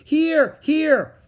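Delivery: two high, loud calls; urgent repeated yell; crying out. A narrator's reading. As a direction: shout your position to someone searching. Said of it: "cried Annie".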